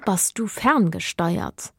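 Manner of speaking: plain reading voice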